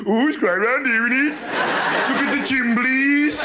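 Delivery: put-on Midlands accent. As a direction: go full Midlands, stretching the last word. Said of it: "we get about halfway to the Midlands".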